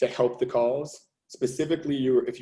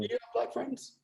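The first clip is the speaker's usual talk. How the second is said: pointed question; 11 semitones higher, 11 dB quieter than usual. Acted. Challenging the listener's claim.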